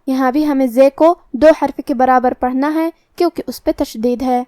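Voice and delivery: usual speaking voice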